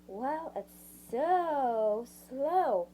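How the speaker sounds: very slow, stretched-out words for a sluggish computer; exasperated drawl. A narrator's reading. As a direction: drag out "so slow" wearily. Complaining about the sluggish computer.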